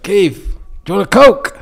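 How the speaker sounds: american guy. Retold or imitated. American guy offering a Coke.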